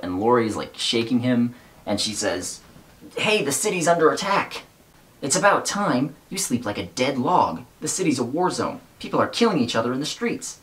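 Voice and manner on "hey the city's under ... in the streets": lightly voiced girl, higher pitched; girl's voice. Reviewer reads Lori's urgent wake-up line, lightly voiced girl. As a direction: read Lori's lines a little higher and urgent.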